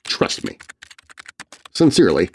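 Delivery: plain reading voice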